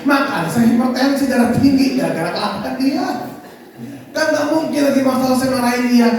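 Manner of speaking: normal talking voice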